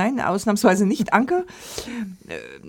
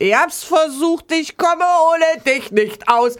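mock yell; 7 semitones higher, slower than usual. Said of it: "habe gebrüllt".

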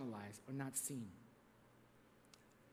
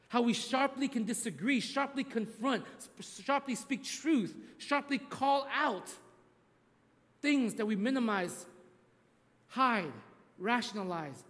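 emphatic preaching; 4 semitones higher, slower than usual. Acted. Preaching with slow building emphasis.

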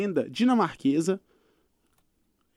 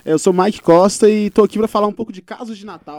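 big booming show intro; loud host greeting; 2 semitones higher, 15 dB louder than usual. Acted. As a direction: belt it like an announcer. Guest opens his segment.

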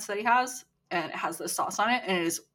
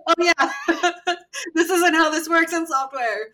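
laughing; 9 semitones higher, 6 dB louder than usual. Real, not acted. Laughing joke about tech.

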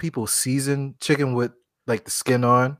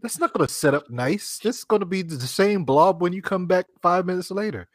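disapproving; 6 semitones higher than usual. Retold or imitated. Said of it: "I'm like".

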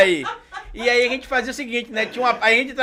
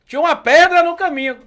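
high, louder theatrical line; comic declamation; 8 semitones higher, 6 dB louder than usual. Acted. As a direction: overplay the poem for laughs. Sketch, rising comic repetition.

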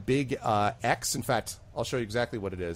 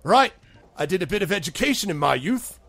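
proud bartender; 8 semitones higher, 5 dB louder than usual. Acted. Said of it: "He says".

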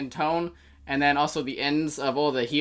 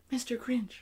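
whispered; 7 semitones higher, 6 dB quieter than usual. Shown as a demonstration. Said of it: "And it's like".

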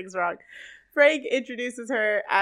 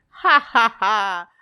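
mock theatrical laugh; 6 dB louder, slower than usual. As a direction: imitate a fortune-teller's dramatic cackle. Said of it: "that laugh".

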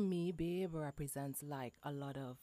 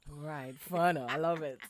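normal talking voice

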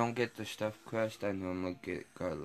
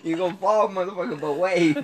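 laughing shout; 11 semitones higher, 12 dB louder than usual. Real, not acted. Laughing at the wave punchline.

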